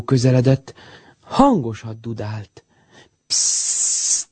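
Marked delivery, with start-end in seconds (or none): 3.3-4.3 long, voiceless "pssst"; soft hissed hush, held out; whispered shush. A narrator's reading. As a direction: shush them under your breath. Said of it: "súgta Jócsont".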